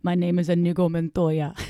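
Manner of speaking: put-on movie-character accent; mock-solemn foreign accent; Inigo Montoya. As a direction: deliver a famous film line in a fake accent. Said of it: "What is that accent?".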